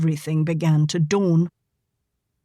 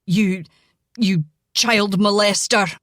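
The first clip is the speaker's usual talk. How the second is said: angry. A narrator's reading. Enraged father accuses Cole.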